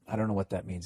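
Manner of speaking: ordinary talk, nothing marked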